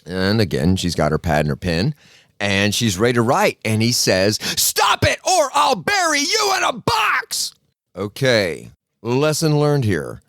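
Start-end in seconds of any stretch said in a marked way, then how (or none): 4.4-7.5 high, full yell slowing on the threat; explode, then stretch out every word; shouted threat. Retold or imitated. Psychiatrist to patient ready to write, high shouted threat.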